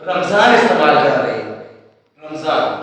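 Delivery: رمضان as ram-zaan, two syllables; common mispronunciation. Shown as a demonstration. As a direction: say رمضان with m straight into z. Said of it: "رمضان نہیں ہے".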